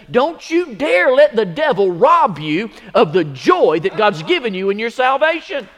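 high, loud, rising pulpit preaching; preaching at full force. Acted. Pastor rallies brothers and sisters against the devil, shouting. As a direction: fire up the church, building to a shout.

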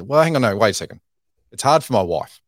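retold friend's indignant protest; sudden loud protest. Friend correcting himself, retold.

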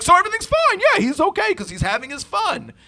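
high, loud mock cheerful dismissal; mocking parent voice. Mocking the adult who thinks the kid is fine. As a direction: parrot the oblivious adult. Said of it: "he's like".

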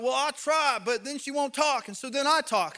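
put-on a fed-up dad venting about his teenage daughter; frustrated dad. A dad at the gym complaining about his daughter, exasperated and fast.

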